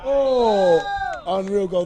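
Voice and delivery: thrilled goal call; excited. Real, not acted. Goal call in live commentary.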